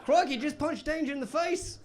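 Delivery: high-pitched, hammed-up Australian; Australian accent. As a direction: put on a cartoonish Aussie tourist accent. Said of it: "That was the best accent I've ever done".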